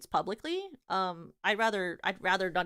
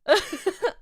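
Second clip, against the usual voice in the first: laugh; 10 semitones higher, 9 dB louder than usual. Real, not acted. Laughing at memory.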